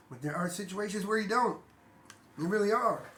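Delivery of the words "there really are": normal talking voice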